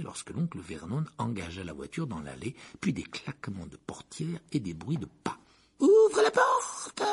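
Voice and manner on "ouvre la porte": high, slow, hissed command; hissing order. Acted. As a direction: hiss the order sharply. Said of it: "d'une voix sifflante".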